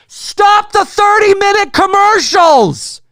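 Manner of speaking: loud, slow, high declaration; a fed-up moviegoer demanding theaters fix the ads, loud; emphatic demand. Real, not acted. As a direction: hammer each word home. Ranting about ads.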